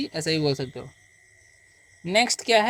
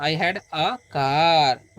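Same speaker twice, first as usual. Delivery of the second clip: धीमा स्पष्ट उच्चारण; much slower than usual. Shown as a demonstration. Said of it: "तो".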